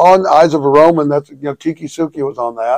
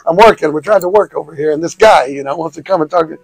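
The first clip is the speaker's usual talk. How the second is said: New York mobster voice; 2 semitones higher, 4 dB louder than usual. Pretend. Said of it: "Can you do a New York mobster voice for us?".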